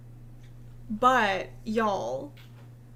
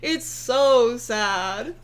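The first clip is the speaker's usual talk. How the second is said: mock pity; 4 dB louder, much slower than usual. Real, not acted. Mock pity over her tweet.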